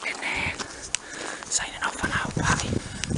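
hushed mock-fearful whisper; whispered sign-off. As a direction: sign off in a whisper so nobody hears.

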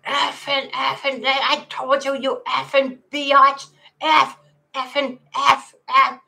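high, loud, hammed-up phone rant; imitating screaming man. Retelling a man in a van screaming into his phone, mock furious yelling. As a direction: mimic an enraged man yelling obscenities. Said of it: "screaming at the top of his lungs".

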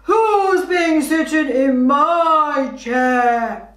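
a hint of a gruff Daddy Bear. Shown as a demonstration. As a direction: growl it out slowly like a big bear. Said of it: "in a really low voice".

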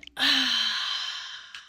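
long, drawn-out breathy sigh. Real, not acted. Streamer reacting to the game, long sigh. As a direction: let out a long audible sigh. Loud sigh alone, no words.

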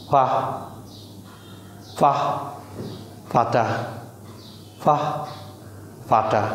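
ফাহ as an airy puff between p and f; short breath-led ফ in ফাহ and ফাটা; Sylheti p sound. Shown as a demonstration. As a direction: drill ফাহ then ফাটা, with pauses. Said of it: "এমন এক sound".

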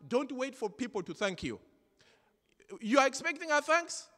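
high, loud preacher's exhortation; preaching at full force. Acted. Pastor exhorting the congregation, loud and high. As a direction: drive the message home to the church.

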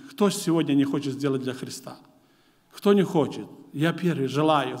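usual speaking voice

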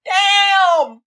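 high cry of damn; excited shout. Real, not acted. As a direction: yell it out high. Reacting to stocking feet.

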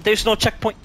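ordinary talk, nothing marked

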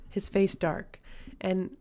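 usual speaking voice

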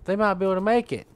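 lightly voiced naive optimist, high and breezy; mock hopeful player. Gamer mocking a hopeful teammate, lightly high and breezy. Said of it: "you're like".

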